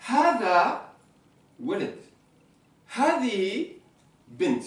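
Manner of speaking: Hada walad, Hadihi bint, each word set apart; repeating for class. Shown as a demonstration. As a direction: model the two phrases again. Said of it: "Alors je redis".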